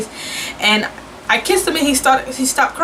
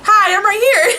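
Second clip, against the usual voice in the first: high, louder chirpy hello; cheery nurse; 11 semitones higher, 4 dB louder than usual. Retold or imitated. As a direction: pop up with a bright cheerful hi. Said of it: "she's like".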